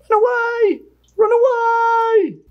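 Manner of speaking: very high, drawn-out comic wail; mock panic. Host voicing the fleeing vinegaroon, mock-panicked high cry. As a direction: cry a retreat in pretend terror.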